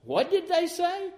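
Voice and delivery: high, puzzled stranger's voice; a grown-up who can't make out the baby talk. Retold or imitated. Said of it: "the grown-up says".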